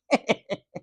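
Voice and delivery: high, short gloating cackle; matron's mocking cackle. A narrator's reading. Matron amused by insulting the porter, high cackle. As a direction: cackle at your own insult.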